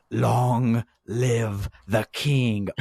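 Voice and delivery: drawn-out, mock-grand delivery; dramatic villain line. Retelling the kick off the ladder, mock-grand and slow. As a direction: stretch each word out theatrically.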